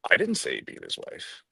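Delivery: "I" leaned on, the rest falling away; stress on I. Shown as a demonstration. Trainer showing how emphasis changes meaning, stress on "I". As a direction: hit the word "I". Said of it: "when I emphasize different words".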